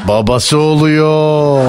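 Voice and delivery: slow, sing-song needling; drawn-out teasing. Real, not acted. Host exposing the caller's relative, drawn-out teasing. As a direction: stretch the words out on a long held note.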